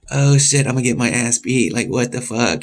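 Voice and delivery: acted-out panicky inner voice; retold alarm. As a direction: act out dread of a coming beating. Acting out his alarm at being targeted.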